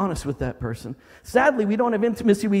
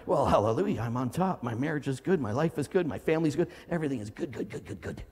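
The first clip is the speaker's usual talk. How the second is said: low, soft mock reassurance fading away; hollow pastor act, sinking in pitch and volume; fake all-is-well pastor; 4 semitones lower, 4 dB quieter than usual. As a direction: insist in a dropped voice, trailing to near nothing. Mimics pastor insisting all is good.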